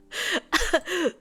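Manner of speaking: loud laugh. Real, not acted. Laughing at AI voice crack.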